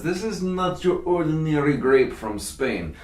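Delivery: hammed-up fake Spanish accent on a wine pitch; mock Spanish accent. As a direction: put on a jokey Spanish accent, lightly. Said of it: "That's my non-Spanish accent".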